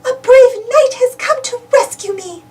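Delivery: a hint of thrilled princess; Daisy's voice. Daisy Beauty greeting her rescuer, delighted. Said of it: "says Daisy Beauty".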